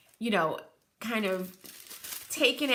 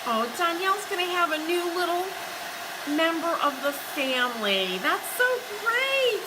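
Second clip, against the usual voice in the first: much higher, gushing with joy over the news; delighted cooing; 9 semitones higher, 4 dB louder than usual. Real, not acted. Host gushing to Danielle about her new cat, delighted squeal. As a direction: squeal with delight, stretching out the last words.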